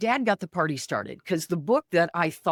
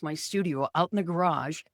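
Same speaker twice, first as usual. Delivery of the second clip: ordinary delivery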